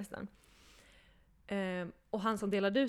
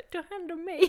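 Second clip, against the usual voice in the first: put-on high, laughing little-girl plea; lightly voiced child asking for care; five-year-old Magda; 9 semitones higher than usual. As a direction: beg to be looked after like a small child. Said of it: "då blev det Magda, fem år".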